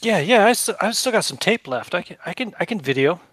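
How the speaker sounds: put-on high, eager young boy; a kid eager to help.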